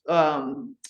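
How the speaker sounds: usual speaking voice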